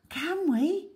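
high, breathless amazement; boy amazed at holding a cub; in wonder. Acted. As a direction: ask as if it's too good to be true. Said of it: "Samir asked in wonder".

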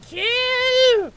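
high, loud shout; battle yell. Real, not acted. Player yelling at his army to fight, battle yell. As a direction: scream the order at the troops.

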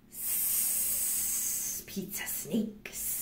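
whispered hissing snake voice. Acted. Greeting the pizza snake at the door, soft whispered hiss. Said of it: "Then what would you say?".

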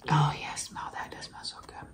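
hushed whisper while smelling. Real, not acted. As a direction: breathe it out in a whisper. Smelling candy.

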